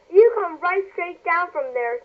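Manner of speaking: high, slow scolding; bossy older sister. A narrator's reading. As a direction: order her down. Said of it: "said Mary".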